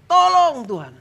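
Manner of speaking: high, loud shout to God; shouted cry for help. Shown as a demonstration. Preacher urges the weak to just shout, loud high cry. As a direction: yell out for rescue. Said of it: "teriakan saja".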